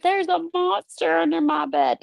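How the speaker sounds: put-on high little-girl voice; young daughter. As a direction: voice a small scared child. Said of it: "She's like".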